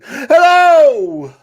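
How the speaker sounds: long, very high, loud hello; excited yelling. Retold or imitated. Imitating an excited caller's greeting, yelling. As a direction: holler it high and drawn out. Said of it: "I just figured he was really excited".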